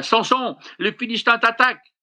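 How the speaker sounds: high, fast alarmed cry. Acted. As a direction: sound the alarm, high and quick. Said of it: "elle s'écria". (cri d'alerte aigu et rapide)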